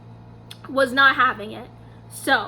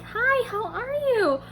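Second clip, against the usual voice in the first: higher, drawn-out friendly hello; casual greeting; 8 semitones higher, slower than usual. Retold or imitated. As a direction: lightly imitate an idol's easy, slow hello. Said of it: "being all casual".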